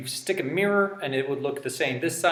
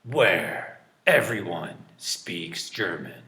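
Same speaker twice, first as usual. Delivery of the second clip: slow, low movie-trailer voice; deep-voiced trailer guy; 7 semitones lower, much slower than usual. Teacher imitating a movie preview announcer, deep and slow. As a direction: draw it out deep and dramatic. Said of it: "that guy with a deep voice".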